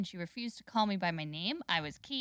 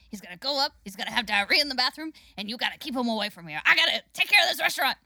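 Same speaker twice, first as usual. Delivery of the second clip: fast, raised crackhead waitress voice; Rita; 3 semitones higher, 5 dB louder, faster than usual. Retold or imitated. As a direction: rattle off orders, building to a loud frantic finish. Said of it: "both of your guys' crackhead voices".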